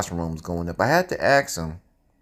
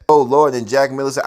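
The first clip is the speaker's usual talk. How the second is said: normal talking voice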